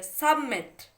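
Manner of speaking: Submit stressed on मिट, falling at the end; teacher demonstrating the stressed मिट in submit; stress on last syllable. Shown as a demonstration. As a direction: lean into the vowel of मिट in submit. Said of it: "आई पे".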